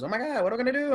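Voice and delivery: hammed-up alarm running downstairs; comic rushing down the stairs in mock panic, high and loud. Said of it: "he goes".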